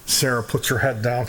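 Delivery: ordinary talk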